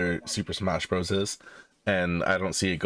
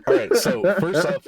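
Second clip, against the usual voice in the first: laughing; 12 semitones higher, 7 dB louder than usual. Real, not acted. Laughs after a joke.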